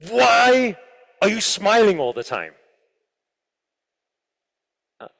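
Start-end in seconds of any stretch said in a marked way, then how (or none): 0.1-2.5 loud, demanding dad voice; dad's suspicious question. Retold or imitated. Dad confronting his son, loud and suspicious. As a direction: press him like a worried father.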